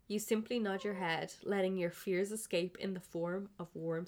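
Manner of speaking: normal talking voice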